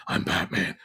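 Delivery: Batman voice. Retold or imitated. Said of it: "He doesn't do the full".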